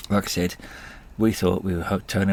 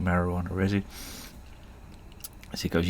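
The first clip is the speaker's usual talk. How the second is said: ordinary talk, nothing marked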